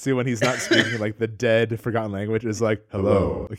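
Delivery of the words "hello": low, slow dragon hello. Retold or imitated. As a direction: greet like an ancient dragon. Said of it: "The dragon's voice".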